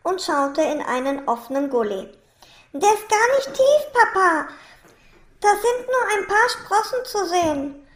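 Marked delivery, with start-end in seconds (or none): 2.7-7.9 put-on high child's voice, coaxing papa; bright-eyed kid urging a parent on; encouraging child. A narrator's reading. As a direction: reassure papa in an eager, high voice.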